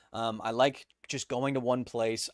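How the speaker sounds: ordinary delivery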